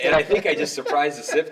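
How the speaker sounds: amused, half laughing as he tells it. Real, not acted. Amused at his own surprise tactic.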